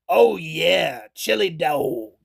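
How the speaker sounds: low, slow, savoring mock movie voice; Drebin chili dog line. Frank Drebin eyeing his chili dog, low slow imitation.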